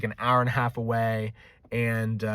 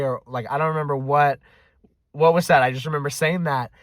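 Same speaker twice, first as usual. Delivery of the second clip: ordinary delivery, nothing marked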